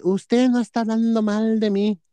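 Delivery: a hint of an angry shout; retold yell. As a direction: imitate the neighbor yelling at her. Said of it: "que se para y que me grita".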